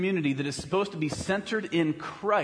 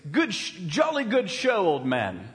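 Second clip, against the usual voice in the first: bad British accent. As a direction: toss it off like a jolly Brit in a film. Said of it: "That's the best British accent I have this morning".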